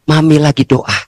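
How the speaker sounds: usual speaking voice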